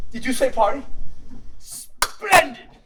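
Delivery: high, delighted Englishman; thrilled by the news of a party. Acted.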